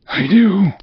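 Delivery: eerie ghost voice; ghost's reply. Acted. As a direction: answer as the wailing ghost.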